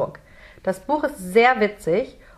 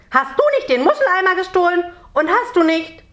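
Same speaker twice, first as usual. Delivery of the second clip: high, fast, loud indignant questioning; angry accusation; 10 semitones higher, 4 dB louder than usual. A narrator's reading. Merman accuses Jonathan of stealing the bucket, angry accusation. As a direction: fire off accusations in a raised scolding voice.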